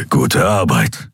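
low, slow grudging praise; gruff growl. A narrator's reading. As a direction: growl the praise over your shoulder. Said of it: "knurrte Kero".

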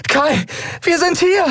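high desperate call into the storm; shouting. Acted. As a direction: call out with all her strength. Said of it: "schrie sie". (verzweifelt rufend, hoch)

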